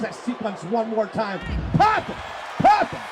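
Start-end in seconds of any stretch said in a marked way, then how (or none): 1.7-3.0 very high, sharp cries; excited egging on. Real, not acted. Commentator urging the wrestler to strike, excited. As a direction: squeal each cry as the hit lands. Calling out blows as they land.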